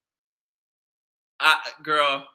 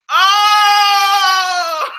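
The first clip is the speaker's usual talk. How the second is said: high loud laughing wail; crying with laughter; 10 semitones higher, 7 dB louder than usual. Real, not acted. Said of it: "I'm crying".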